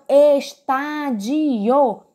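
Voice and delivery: "estádio" stretched slowly, es-TÁ-di-o, each syllable sounded; drawn-out syllables. Shown as a demonstration. Teacher showing students where TÁ falls, slow syllabled "estádio". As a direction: draw out every syllable of "estádio". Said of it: "como tá é a antepenúltima".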